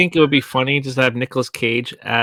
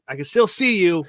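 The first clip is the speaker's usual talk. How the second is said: higher, louder mock yell; retold raised-voice jab; imitated yelling; 6 semitones higher, 6 dB louder than usual. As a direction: call it out like scolding from the doorway. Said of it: "He's, like, yelling at him".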